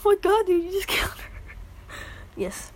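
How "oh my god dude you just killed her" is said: higher, louder amazed "oh my god"; much higher excited bystander; excited reaction. Retold or imitated. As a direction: hype up the roast.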